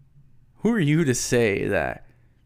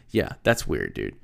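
normal talking voice

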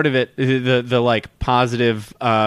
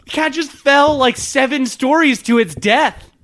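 disgusted laughter; 9 semitones higher than usual. Real, not acted. Recounting the video in shock.

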